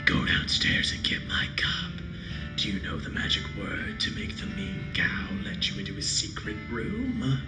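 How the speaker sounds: creepy whispered doll voice; eerie breathless doll whisper; talking doll. Acted. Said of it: "the doll's eyes".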